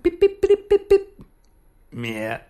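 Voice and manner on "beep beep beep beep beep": very high little bird chirps; finch chirps. Retold or imitated. Telling about the escaped finch, very high chirping beeps. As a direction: chirp like a tiny zebra finch.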